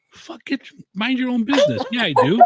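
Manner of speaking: hammed-up indignation at the app; mock defiance. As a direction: talk back to the phone in playful outrage. Mock defiance at a tip prompt.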